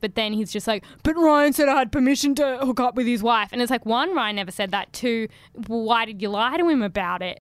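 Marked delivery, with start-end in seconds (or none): 1.0-3.5 indignant mock self-justification; protesting excuse. Imitating a man excusing himself after trying to apologise, protesting. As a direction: push out a defensive excuse in one breath.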